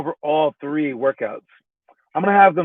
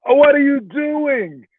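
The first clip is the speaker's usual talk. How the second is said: much higher, slow, incredulous; mock disbelief; 10 semitones higher, slower than usual. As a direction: stretch it out in mock horror. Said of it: "And I'm like".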